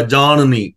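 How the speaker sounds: ਜਾਣਨੀ said with the retroflex ਣ in the middle and a plain ਨ before the final ਨੀ; careful pronunciation. Shown as a demonstration. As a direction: spell out ਜਾਣਨੀ slowly: ਜਾ-ਣ-ਨੀ. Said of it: "ਨੰਨਾ ਵਿਚਕਾਰ ਬੋਲ ਜਾਏਗਾ".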